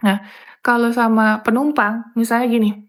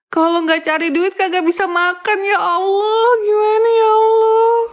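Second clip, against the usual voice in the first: high, wailing mock sob to God; hammed-up tearful plea, rising to a cry; crying alone in the lavatory, a wailing mock lament; 7 semitones higher than usual. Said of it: "Cuma nangis gini".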